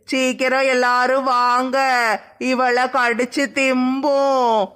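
slow, drawn-out eerie ghost voice, rising to a shout; hungry ghost voice. Acted. Ghost menacing the frightened woman in the forest, slow and eerie. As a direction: play a hungry ghost planning to eat her. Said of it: "என்னைய தான் சொல்லுது பேய்".

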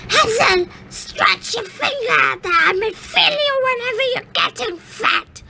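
put-on high, wheedling old witch. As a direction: coax the boy to show his finger. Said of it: "the old witch came to the cage and said".

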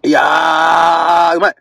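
long drawn-out cry of pleasure; getting hyped. Real, not acted. Eating in the car, a long groaning cry of pleasure. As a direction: moan with joy at the taste. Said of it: "テンション上がってきた".

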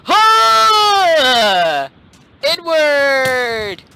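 high, loud drawn-out yell; battle cry. Real, not acted. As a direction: shout it like a charge. Battle cry during game attack.

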